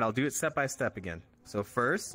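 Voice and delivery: ordinary talk, nothing marked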